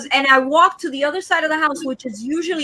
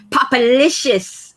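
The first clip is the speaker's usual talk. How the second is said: savoring the name; much slower than usual. Real, not acted. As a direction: stretch Popalicious out slowly, relishing each syllable. Savoring a viewer's new name.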